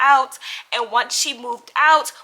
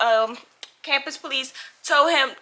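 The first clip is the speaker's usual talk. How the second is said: ordinary delivery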